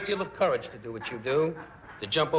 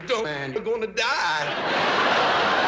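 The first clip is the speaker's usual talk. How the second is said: slow, loud, higher pleading; mock panicked plea; 4 semitones higher, 4 dB louder, much slower than usual. Color guy warning the stuntman, loud and drawn out. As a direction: beg him to stop like a frantic friend. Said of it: "the color guy going".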